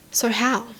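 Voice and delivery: ordinary delivery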